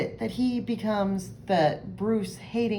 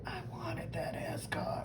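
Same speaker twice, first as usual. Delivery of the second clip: whispering; 8 semitones higher, 11 dB quieter than usual. Acted. Bruce sulking over ascot.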